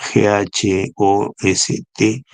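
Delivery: slow, letter-by-letter spelling of G H O S T; spelled out letter by letter. Shown as a demonstration. Lecturer spelling the acronym for students, slowly letter by letter. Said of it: "ahí viene gost".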